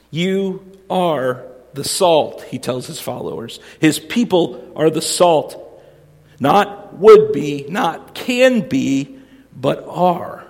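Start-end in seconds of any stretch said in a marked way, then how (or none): 0.1-2.4 slow, weighty "You are the salt" with a falling end; emphatic statement. Shown as a demonstration. Preacher stressing to his congregation: slow emphatic "You are the salt". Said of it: "it's in the emphatic sense". 6.4-10.4 slow, punched "Not would be, not can be, but are"; emphatic contrast. Shown as a demonstration. Pastor drives home to his listeners: slow emphatic contrast. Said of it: "It's emphatic".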